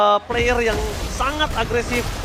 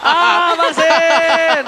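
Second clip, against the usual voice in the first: loud cheering call; excited shout; 6 semitones higher, 8 dB louder, much slower than usual. Real, not acted. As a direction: shout the name with delight. Cheering guest player.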